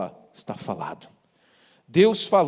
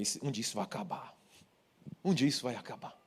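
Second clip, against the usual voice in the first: muttered to himself; 4 semitones lower, 9 dB quieter, faster than usual. Retold or imitated. Inner thought, muttered to himself.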